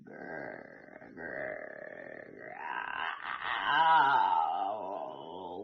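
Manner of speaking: very low, soft growling. A narrator's reading. The unseen growl frightening Dragon, low and soft. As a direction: growl quietly from the dark. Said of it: "Then suddenly".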